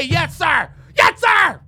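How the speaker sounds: shouted high, hammed-up obedience; mock subservient yes sir. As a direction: bark it like a mocked underling. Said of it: "I gotta fucking say".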